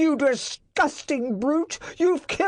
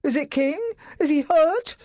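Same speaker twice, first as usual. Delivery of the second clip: high, louder anxious questions; 12 semitones higher, 5 dB louder than usual. A narrator's reading. Master asking footman, anxious and loud. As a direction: fear for the dog in every word.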